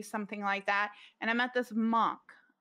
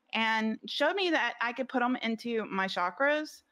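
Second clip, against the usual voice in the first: ordinary talk, nothing marked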